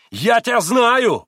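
slow, high, loud agitated cry; irritated exclamation. Acted. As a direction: shake a fist and shout it. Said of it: "восклицал он раздражённо".

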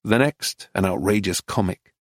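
plain reading voice

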